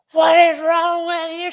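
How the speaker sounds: high, loud, slow yell; hollering. Retold or imitated. Angry lady yelling at another shopper, high and loud. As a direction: holler at her like you've lost patience. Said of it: "She's hollering".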